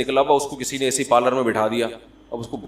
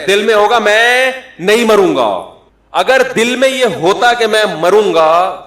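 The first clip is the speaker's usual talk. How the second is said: loud, high, hammering sermon; rising preacher's fervor; fiery preaching; 5 semitones higher, 6 dB louder than usual. Acted. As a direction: drive each line home to the crowd. Preaching builds to full force.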